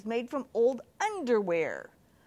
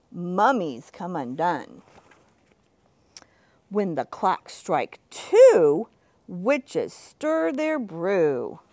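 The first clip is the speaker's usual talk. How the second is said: slow, dramatic reading; spooky read-aloud; 3 semitones lower, 6 dB louder, slower than usual. Acted. Teacher reading the Halloween book to children, slow and dramatic.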